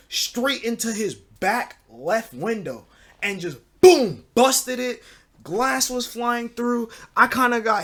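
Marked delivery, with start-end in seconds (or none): 3.8-4.2 loud, drawn-out boom; imitated crash. Storyteller imitating a bat hitting the window, loud boom. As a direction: punch out the impact noise.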